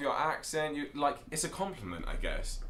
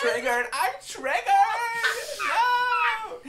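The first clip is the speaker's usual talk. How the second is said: high, loud mock outrage shriek; hammed-up triggered viewer; 8 dB louder, slower than usual. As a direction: shriek it like a melting snowflake. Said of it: "be triggered".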